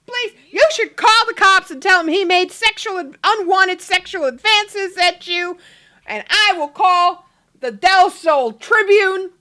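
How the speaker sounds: high, loud indignant rant; hammed-up outrage, pushing each phrase; going off. Husband urging wife to report it, loud hammed-up ranting. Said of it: "he's just going off".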